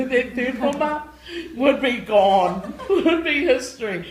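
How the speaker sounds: loud, high laughing words. Real, not acted. Joking about a former student, loud laughing. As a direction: laugh through it, slowly at first.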